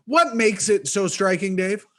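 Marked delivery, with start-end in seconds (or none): none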